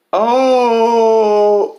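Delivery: high, loud mock crying wail. Mocking a Facebook friend's grief post with a loud wail. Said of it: "she types".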